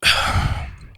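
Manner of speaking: weary villain's sigh; long sigh. Acted. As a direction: let out a long, audible breath. Captor sighs before answering.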